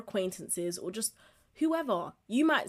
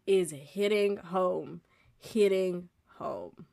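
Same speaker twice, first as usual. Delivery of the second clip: slow savoring echo; 3 semitones lower, much slower than usual. Acted. Savouring the phrase.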